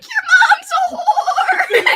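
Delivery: very high, loud, slow laughing shout; gleeful yell. Real, not acted. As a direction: yell it in delight at her return. Greeting Crystal as she returns.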